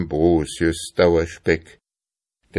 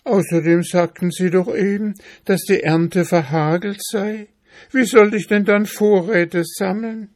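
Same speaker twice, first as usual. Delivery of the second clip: high-pitched little mouse arguing back; squeaky-high, puzzled mouse; questioning mouse; 13 semitones higher, 4 dB louder than usual. Acted. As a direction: argue plaintively in a high voice.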